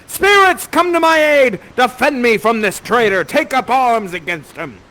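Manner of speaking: high, loud theatrical summons; commanding shout. Acted. Prospero calls spirits against the traitor, commanding shout. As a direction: call out for aid, starting at full force.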